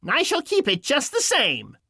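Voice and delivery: high, gloating witch voice; gleefully mean witch; mocking witch. A narrator's reading. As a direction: taunt her as a high-pitched witch. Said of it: "laughing at her".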